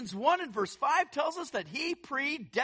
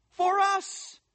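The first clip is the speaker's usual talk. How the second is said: emphatic preached repeat; 9 semitones higher, 4 dB louder than usual. Real, not acted.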